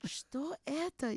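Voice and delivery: high, slow, alarmed "Что это?"; startled Russian question. Retold or imitated. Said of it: "I just said in the Russian language".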